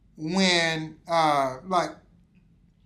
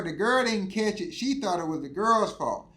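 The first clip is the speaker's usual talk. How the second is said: ordinary delivery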